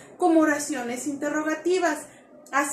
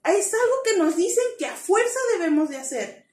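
usual speaking voice

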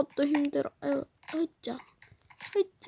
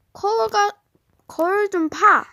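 urgent call; 4 semitones higher, 6 dB louder than usual. Acted. 엄마가 재촉하며 외침.

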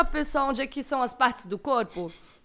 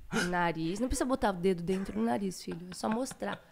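soft; 6 semitones lower, 6 dB quieter than usual. Real, not acted. Soft talk to baby.

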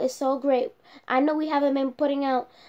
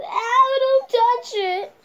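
louder, higher slow cry of pain; pained yelp; 10 semitones higher, 5 dB louder, much slower than usual. Pretend. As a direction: yelp and pull away. Doctor touches broken arm.

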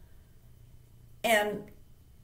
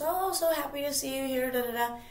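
lightly acted, higher cheery greeting; breezy greeting; 5 semitones higher than usual. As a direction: imitate a host's gushing hello, tailing into filler. Hef greets her at table.

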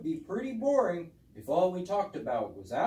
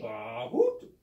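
goat bleat with hoot; 9 semitones higher than usual. Pretend. Puppet owl imitating the goats' hello. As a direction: bleat then let a hoot slip. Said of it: "It sounded like this".

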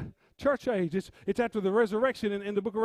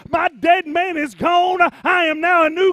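full-force preaching; 6 semitones higher, 14 dB louder than usual. Real, not acted. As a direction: build to high, slow, shouted declarations. Preaches, building to full shout.

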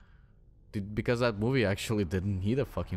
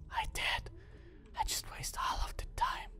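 whispered aside; 9 dB quieter than usual. Real, not acted. Muttered aside.

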